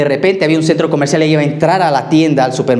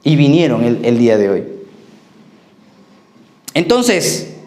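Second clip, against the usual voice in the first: higher, fervent sermon voice; preaching; 4 semitones higher than usual. Acted. Pastor telling the congregation about the eagle, fervent preaching. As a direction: press the point home to the flock.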